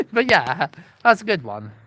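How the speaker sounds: normal talking voice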